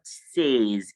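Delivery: says as a drawn-out SAYZ; slow, long AY in says; wrong pronunciation. Shown as a demonstration. Teacher pleading with students, says as SAYZ. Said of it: "it is not says".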